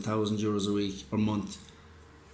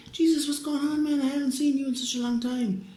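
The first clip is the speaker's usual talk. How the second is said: much higher, overjoyed voice; imitating Perez; 14 semitones higher than usual. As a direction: greet an old friend with excitement, pitched way up. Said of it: "And he's going".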